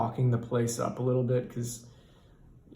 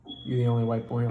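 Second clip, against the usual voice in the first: driver voice; faster than usual. Retold or imitated. Repeat in driver's other voice.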